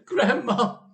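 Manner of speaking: high, loud alarmed shriek; wife shrieking for grandma. Acted. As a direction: scream her name. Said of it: "shrieked Missus Cranky".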